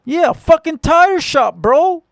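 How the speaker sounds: loud, high, slow cocky comeback; a cocky LA kid mouthing off; cocky retort. Retold or imitated. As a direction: brush him off like you own the place. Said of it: "And I'm cocky".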